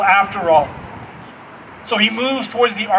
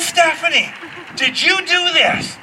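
dad questioning; 7 semitones higher than usual. Retold or imitated.

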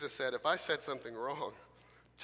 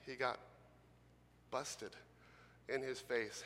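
ordinary delivery

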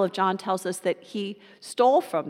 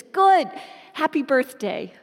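put-on much higher, louder joyful child voice; a daughter springing her surprise gift; delighted little girl; 7 semitones higher, 4 dB louder than usual. Daughter reveals hat is a gift.

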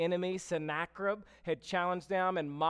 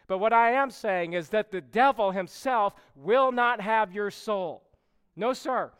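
louder, rising preacher's build to a shout; preaching at full force; 3 semitones higher, 6 dB louder than usual. Acted. Preacher promises the congregation God will rescue them, loud and fervent. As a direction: preach it home with full conviction.

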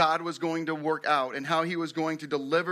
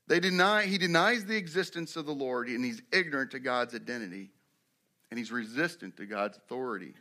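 ordinary talk, nothing marked

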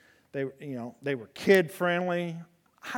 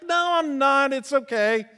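mock reassuring; 9 semitones higher, 8 dB louder, slower than usual. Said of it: "I'm like".

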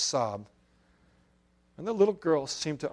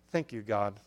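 quiet prayer; 6 semitones lower, 6 dB quieter than usual. A narrator's reading. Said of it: "I began to pray".

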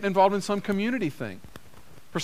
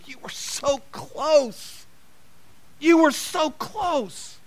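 put-on higher, slow, aching "so close" to a child; a parent gently lamenting a near miss; disappointed parent; 7 semitones higher, slower than usual. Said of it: "it sounded an awful lot the way we sound as parents".

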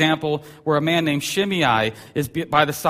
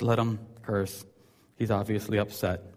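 calm, quiet David; 5 semitones lower, 7 dB quieter than usual. Retold or imitated. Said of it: "and David says".

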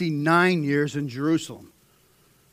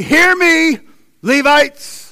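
high, loud summons; commanding call; 11 semitones higher, 13 dB louder, much slower than usual. Acted. King Hezekiah addressing the gathered Levites, loud command.

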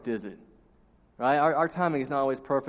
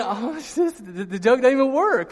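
laughing at himself; 8 semitones higher, 4 dB louder than usual. Retold or imitated. Kid realizes prank fails.

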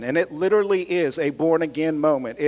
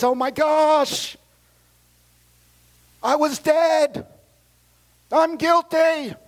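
high, drawn-out exclamation; acted cry of guilt, pitched high; anguished realization; 9 semitones higher, slower than usual. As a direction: wail it out as sudden conviction hits. Acting a newborn believer's crying realization.

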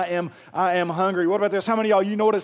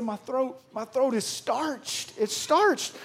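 mock pitiful; 5 semitones higher, 4 dB quieter, much slower than usual. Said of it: "I said".

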